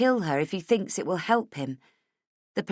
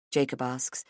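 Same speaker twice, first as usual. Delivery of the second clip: usual speaking voice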